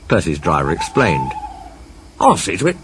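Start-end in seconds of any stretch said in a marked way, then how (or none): 2.2-2.8 lightly voiced high signalman; brisk helpful railwayman. Signalman character voice.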